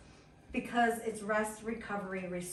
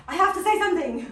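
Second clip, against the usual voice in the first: high, loud and quick, bursting to tell; excited quote; 8 semitones higher, 12 dB louder, faster than usual. Retold or imitated. Paula bursting to tell the group, excited and loud. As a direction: blurt it out like big news. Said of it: "We were dying laughing".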